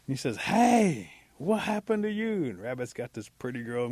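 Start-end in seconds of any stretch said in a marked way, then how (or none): 0.4-2.4 loud, high cry of protest; indignant call. Acted. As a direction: call your friend to account. Possum confronts Rabbit with a girl.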